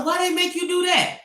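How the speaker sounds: high, baffled question; incredulous question. Retold or imitated. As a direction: sound amazed anyone would demand that. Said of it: "He said".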